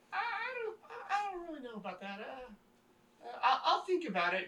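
stammer, unsure and uneasy; hesitant woman. Acted. Hesitant mother answering priest.

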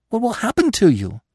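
high, urgent question; persistent. A narrator's reading. Bambi worries over fallen Gobo, persistent. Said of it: "Bambi persisted".

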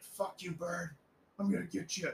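high, hammed-up threat at a bird; mock threat to bird. Clerk threatening the bird with a broom, mock menace.

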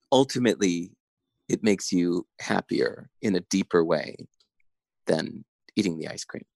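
ordinary talk

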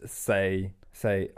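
usual speaking voice

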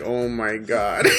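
mock exasperated listener. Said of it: "Everyone's like".

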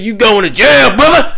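high, loud mock police shout; acted arrest announcement. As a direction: crow it at the suspect. Acted police reply in story.

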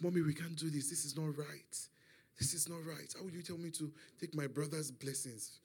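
hushed, fearful pleading under the breath; very soft, anxious near-whisper; afraid. Retold or imitated. Frightened son whispers to his mother about tricking his father, hushed and afraid. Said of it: "Jacob was afraid".

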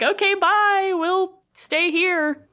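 high, breezy, slowing down; casual parents waving them off; oblivious parents. Retold or imitated. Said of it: "the parents are like".

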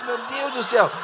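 high-pitched comic lament; mock crisis. Embarrassed cell member dwelling on his mistake, mock crisis. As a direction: wail it lightly in pretend dismay. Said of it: "ele vai numa crise profunda".

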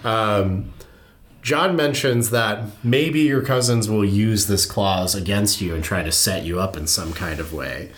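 normal talking voice

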